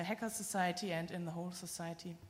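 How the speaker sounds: ordinary delivery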